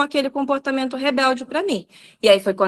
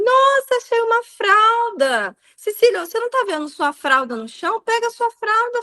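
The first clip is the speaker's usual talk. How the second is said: high sing-song make-believe amazement; playful mock surprise; 11 semitones higher than usual. Mom pretending to discover her daughter's diaper, sing-song surprise. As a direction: coax a toddler with exaggerated wonder. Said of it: "crio toda uma narrativa".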